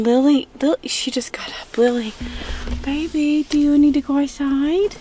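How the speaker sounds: high sweet dog-talk voice. Real, not acted. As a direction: coo at the dog. Talking to her dog.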